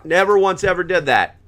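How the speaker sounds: ordinary talk, nothing marked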